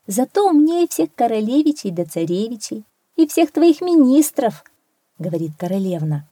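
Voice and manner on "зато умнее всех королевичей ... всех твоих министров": higher, lightly acted retort; insistent princess. A narrator's reading. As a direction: argue back as the princess, pitched up. Princess argues with her father. (слегка сыгранная настойчивая королевна)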